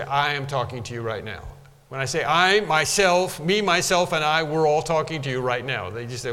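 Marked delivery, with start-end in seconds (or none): 2.2-5.9 "I, myself, me, myself, and I" hit one by one; overdone emphasis on "I, myself, me"; over-emphatic. Shown as a demonstration. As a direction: hammer each "myself" and "me", then run on. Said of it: "that's a little too emphatic for me".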